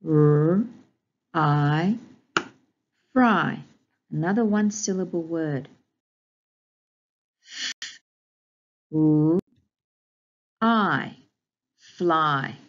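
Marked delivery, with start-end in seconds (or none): 0.1-3.6 loud R and I, then blended fry; sounding out phonics. Shown as a demonstration. Teacher modelling segmenting, loud separate sounds. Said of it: "sound out each of these graphemes". 7.4-12.6 F, u, I said apart, then fly; sounding out phonics. Shown as a demonstration. Teacher modelling segmenting, loud separate sounds. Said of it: "sound out each of these graphemes".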